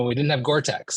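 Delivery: ordinary talk, nothing marked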